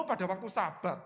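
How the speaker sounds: usual speaking voice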